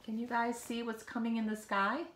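usual speaking voice

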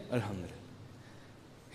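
hushed, drawn-out imitation of a relieved sigh; mock relief. Woman caller freed from her husband, mock hushed relief. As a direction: let it out very slowly, almost voiceless, imitating her relief. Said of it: "مجھے اطمینان ہو گیا".

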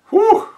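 high excited whew; whistle whew. Real, not acted. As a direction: let out a long whew. Reacts to a picture.

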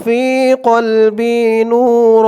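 slow, drawn-out Quranic recitation. Shown as a demonstration. Teacher intoning the opening to viewers, slow recitation. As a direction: intone each word slowly and devoutly.

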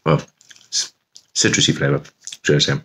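talking while chewing chili. Real, not acted. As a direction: speak around a mouthful. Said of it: "let me just chew on this one".

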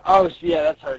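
ordinary delivery, nothing marked

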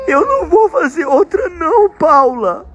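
high, slow fake sob; mock crying. Dad pretending to cry to tease his daughter, slow and high. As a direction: whimper it as a pretend crying kid.

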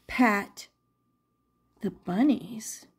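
plain reading voice

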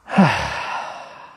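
long, loud, falling sigh; heavy sigh. Real, not acted. Settling in for evening meditation, heavy sigh. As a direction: let out a big low breath.

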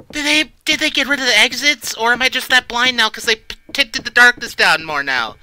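being chased. Real, not acted. Searching exits while chased.

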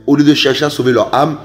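ordinary talk

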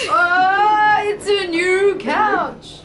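high, stretched-out gushing; excited cheer. Real, not acted. Echoing a friend's joy about the couch, excited cheer. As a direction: draw it out high with delight.

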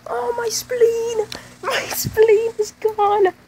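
very high, slow howling wail; overacted agony, dragged out; hammed-up pained wail. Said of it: "Here's a reenactment".

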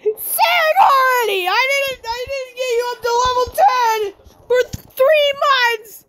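high-pitched, exasperated yelling tirade; screaming rant. Pretend. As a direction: yell at a slacker at the top of your pitch. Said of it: "I'm still yelling; how deafening my screaming is".

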